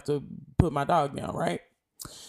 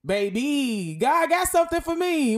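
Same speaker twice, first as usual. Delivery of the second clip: sassy comeback; 8 semitones higher, 5 dB louder than usual. Pretend. Sassy imagined reply.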